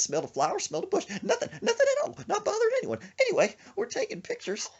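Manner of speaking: high, frantic falsetto building to a shriek; hammed-up scared storytelling, rising to a loud squeal; Gomer Pyle. Scared friend recounts the snake, high hysterical imitation. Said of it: "he does that when he gets scared".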